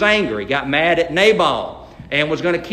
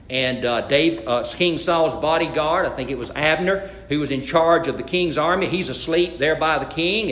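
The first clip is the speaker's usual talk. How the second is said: usual speaking voice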